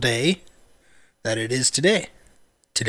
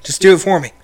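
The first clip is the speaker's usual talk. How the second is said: loud, higher mock request; hammed-up order to a dog; joking plea; 6 semitones higher, 11 dB louder, faster than usual. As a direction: plead loudly with laughter close behind. Joke plea to dog, amid laughter.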